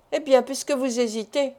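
ordinary delivery

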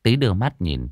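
ordinary delivery

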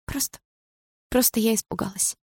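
soft, high, halting voice; tearful sob. A narrator's reading. Dana telling Misha of her fright, tearful. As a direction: falter tearfully, small and high. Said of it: "всхлипнула Дана".

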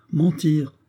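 a grenadier mocking the officer's outrage; insolent retort. A narrator's reading. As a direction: throw the word back insolently. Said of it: "répéta le grenadier avec une insolence".

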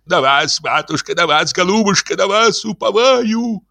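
high, rising singsong plea; chanting entreaty that climbs in pitch; gushing supplication. Acted. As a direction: implore the lady like a fawning priest. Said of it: "зачастил".

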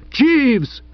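high-pitched call; cry out. A narrator's reading. Chuffy calls the butler back, crying out. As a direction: cry out the name, falling away. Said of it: "cried Chuffy".

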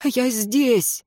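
high, plaintive little call; pitiful call. A narrator's reading. Dead servant calls his master, pitiful. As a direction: call weakly for help. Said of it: "жалобно позвал мертвец".

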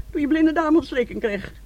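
ordinary delivery

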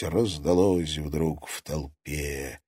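plain reading voice

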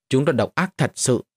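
ordinary delivery

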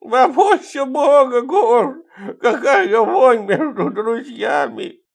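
very high, slow, loud lisp with laughter; laugh-crying toothless drunk; high lisping drunk laughing through it; lisping Fima. Acted.

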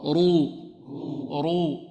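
heavy, rounded رو. Shown as a demonstration. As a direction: say رو with a thick, emphatic r. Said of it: "والراء المضموم مفخمة".

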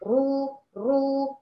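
رو drawn out long with a heavy back R; مد الراء. Shown as a demonstration. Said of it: "ارتفع بأقصى اللسان". (رو ممدودة مفخمة)

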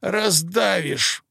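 high, slow strained cat cry; hissing cat. Acted. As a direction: drag it out, high and pained. Cat pinned under the girl.

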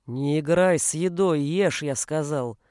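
low, slow menacing order; growling. A narrator's reading. Father forcing son to eat, growling. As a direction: growl it slowly, deep in the voice. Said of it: "прорычал Бруно".

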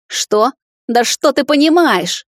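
high, loud, slow retort; indignant, stretched-out question; scornful snort. A narrator's reading. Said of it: "фыркнула она".

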